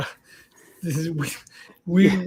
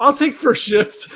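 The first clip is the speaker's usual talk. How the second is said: laughing; 7 semitones higher, 4 dB louder than usual. Real, not acted. Joking after kill-us remark.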